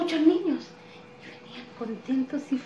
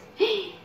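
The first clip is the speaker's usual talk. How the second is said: storyteller's gasp; 10 semitones higher than usual. Acted. Reacting to the wind.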